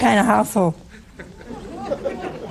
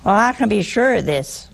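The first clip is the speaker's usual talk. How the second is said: usual speaking voice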